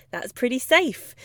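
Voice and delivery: normal talking voice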